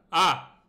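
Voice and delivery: short, loud, high "ah"; test scream. Shown as a demonstration. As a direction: yell one quick test at the mic. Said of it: "I have to actually scream".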